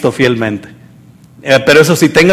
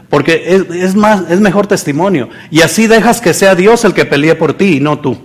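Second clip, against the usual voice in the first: ordinary talk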